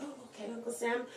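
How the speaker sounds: very soft, reluctant agreement; meek reply. Retold or imitated. Humoring Uncle Sam about the roaches, meek and soft.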